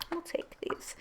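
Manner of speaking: barely voiced whisper to herself. Real, not acted. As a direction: murmur it under your breath while picking cards. Quiet aside while shuffling.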